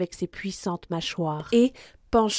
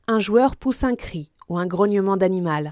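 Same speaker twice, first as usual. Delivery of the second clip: ordinary delivery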